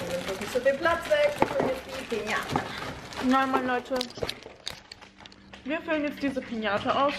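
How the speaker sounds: choked-up, tear-stained voice; snotty crying. Real, not acted. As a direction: speak through leftover tears. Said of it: "so verheult aussehe".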